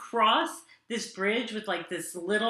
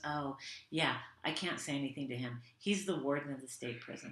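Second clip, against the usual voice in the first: low, hushed mock-helpless manager; understated imitation of a cowed manager; quiet resigned manager; 6 semitones lower, 12 dB quieter than usual. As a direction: drop it low and soft, as if confiding. Manager reacting to their complaint.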